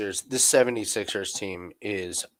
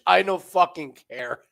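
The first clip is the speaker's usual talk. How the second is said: defiant high retort; 6 semitones higher, 4 dB louder than usual. Retold or imitated. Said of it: "reply to boss".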